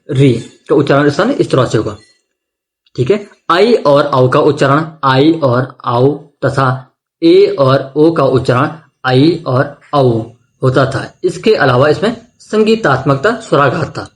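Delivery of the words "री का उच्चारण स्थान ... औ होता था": ordinary talk, nothing marked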